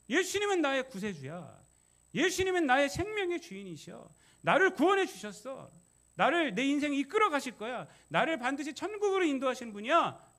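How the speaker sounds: preacher voicing a believer declaring Jesus his saviour; fervent confession. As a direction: cry out the faith with full conviction. Voices believer's bold confession.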